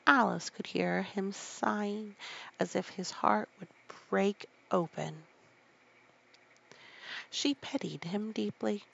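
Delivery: usual speaking voice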